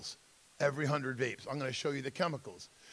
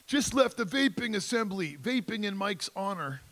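lightly voiced teen boast, higher; kid's gloating post; 6 semitones higher than usual. Teen posting to Snapchat after the assembly, mock boastful. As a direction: read it out like a mock-proud caption.

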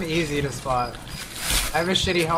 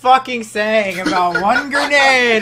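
exasperated yell; 6 semitones higher, 6 dB louder, slower than usual. Real, not acted. Team wiped by grenade.

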